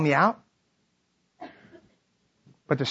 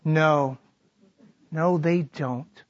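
ordinary talk

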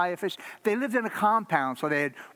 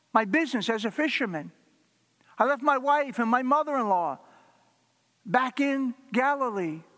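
a broken disciple crying out that he gave up everything; anguished thoughts; 7 semitones higher than usual. Acted. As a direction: pour out Peter's panic as everything collapses. Peter's frantic inner thoughts.

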